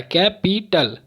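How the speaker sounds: "Capital" in three beats, कै, पी, टल; syllable by syllable. Shown as a demonstration. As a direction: chop "capital" into three parts. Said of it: "तीन बार मेरे रुकावट आ रही है". ("Capital" को तीन हिस्सों में तोड़कर)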